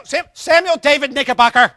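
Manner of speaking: put-on a parent losing patience, yelling the child's full name; exasperated parent yell. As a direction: sputter the full name in frustration. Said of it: "after you said it three or four times".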